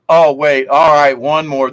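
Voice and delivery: ordinary talk, nothing marked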